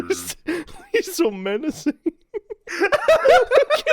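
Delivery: high, laughing squeal; helpless giggling at the cat; cracking up. Real, not acted. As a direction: barely get the words out through laughter. Cracking up over the cat's glare.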